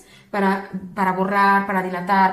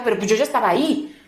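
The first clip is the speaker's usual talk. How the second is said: normal talking voice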